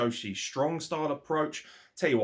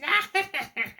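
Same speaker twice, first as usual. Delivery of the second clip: high, loud put-on witch cackle; mock witch cackle; 6 dB louder than usual. Mocking Vicky Guerrero's cackle, a high loud witch cackle. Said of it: "just cackles like a witch".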